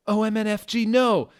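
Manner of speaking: high, loud panicked shout; a shrill yell of horror at the knife. Acted. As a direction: scream it out in alarm. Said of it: "shouted Lucan".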